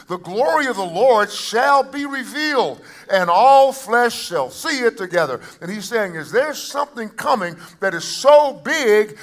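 high-pitched, forceful declaration; full-force preaching. Acted. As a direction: preach it out to the whole congregation.

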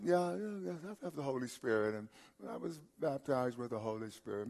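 mock-casual, soft offhand mumble; nonchalant. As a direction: imitate someone shrugging it off, soft and careless. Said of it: "sort of a nonchalant".

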